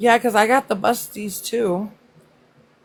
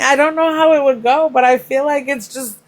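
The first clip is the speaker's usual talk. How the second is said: excited higher rant; 6 semitones higher, 4 dB louder than usual. Real, not acted.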